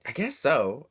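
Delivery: normal talking voice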